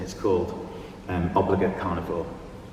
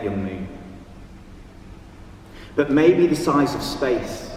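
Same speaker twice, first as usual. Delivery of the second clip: measured poem recital with long pauses; raised, projected voice, phrase by phrase, letting each line hang; poetry reading; 4 semitones higher than usual. Acted. Reads own poem aloud.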